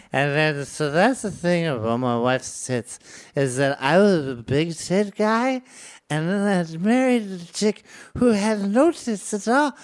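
put-on drunk guy rambling. Drunk friend oversharing about his wife, loud and slurred. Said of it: "they start going".

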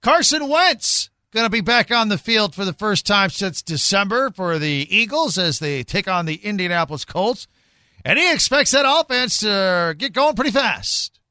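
high, loud sports-radio lead-in; sports update read. Real, not acted.

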